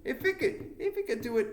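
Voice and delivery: high, laughing shrug of a thought; high laughing musing. Retold or imitated. Host recalling his podcast idea, high laughing shrug. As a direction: toss it off lightly with a laugh. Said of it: "I thought".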